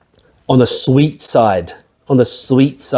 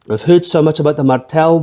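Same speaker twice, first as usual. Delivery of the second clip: ordinary talk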